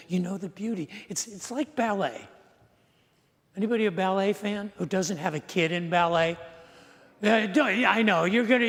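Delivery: normal talking voice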